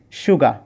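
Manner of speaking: সুগা with no r sound at the end; correct pronunciation. Shown as a demonstration. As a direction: say সুগা, dropping the final r. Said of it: "আবার শুনুন". (শেষে র ছাড়া "সুগা" উচ্চারণ)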